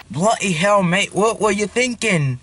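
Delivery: put-on British accent. Younger brother taking his turn in the challenge, mock British accent. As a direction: imitate a British scolding.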